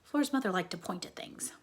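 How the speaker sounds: plain reading voice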